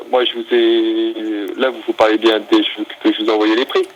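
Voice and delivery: ordinary talk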